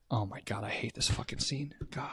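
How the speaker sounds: unvoiced, spooked whisper; real whisper. Real, not acted. Host spooked by the scene, whispering. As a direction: breathe it out, creeped out.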